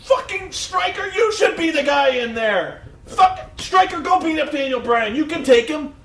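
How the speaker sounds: high, loud mock ranting; hammed-up exasperated shouting; losing it. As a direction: imitate a frantic commentator egging on his colleague. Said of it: "Cole lost his shit".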